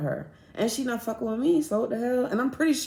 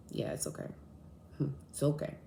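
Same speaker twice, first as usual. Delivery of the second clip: unvoiced, soft it's okay; whispered reassurance; 5 semitones lower, 12 dB quieter than usual. Telling her friend to go say hi, whispered reassurance. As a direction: whisper it gently to calm her. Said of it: "reassuring friend softly".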